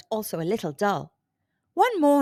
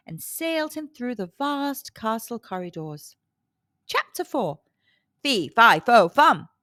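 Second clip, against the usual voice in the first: ordinary delivery, nothing marked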